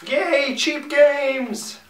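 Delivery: very high, loud, slow cheer; drawn-out excited squeal. Acted. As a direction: squeal it like a thrilled child. Mock cheer at news item.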